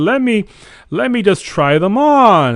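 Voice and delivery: high, loud and eager. Retold or imitated. Telling the dream, eager to try the slippers on. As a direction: jump at the chance to try them. Excited quote of trying on slippers.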